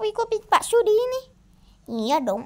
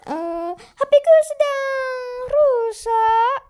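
slow whiny voice; 6 semitones higher, 5 dB louder, much slower than usual. Acted.

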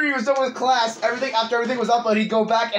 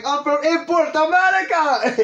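higher-pitched mock Russian gamer; imitating a Russian player, pitched up; imitated Russian guy; 6 semitones higher than usual. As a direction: put on a Russian guy's excited announcement. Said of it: "the Russian guy's like".

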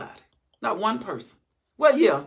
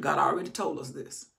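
ordinary talk, nothing marked

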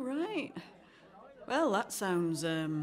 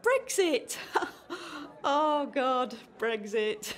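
nervous laugh; 3 semitones higher, much slower than usual. Real, not acted.

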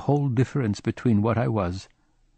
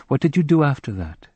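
uneasy; 6 semitones higher, 4 dB louder than usual. A narrator's reading. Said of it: "she asked uneasily".

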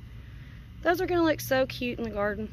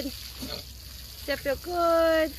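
very slow, higher coo to a pet; drawn-out cooing; 5 semitones higher, much slower than usual. Real, not acted. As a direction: stretch every word out, cooing to an animal. Cooing to pig being hosed.

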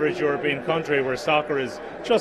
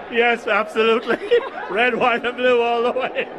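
laughing; 8 semitones higher, 3 dB louder than usual. Real, not acted. Guest laughs while answering.

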